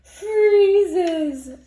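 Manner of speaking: big loud storybook roar. A narrator's reading. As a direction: let the roar burst out. Bear roars and sneezes.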